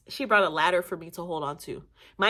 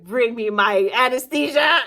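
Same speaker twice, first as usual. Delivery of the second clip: slow, louder mock yell demanding the drug; 3 semitones higher, 8 dB louder, slower than usual. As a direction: bark the order at him slowly. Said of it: "I was yelling".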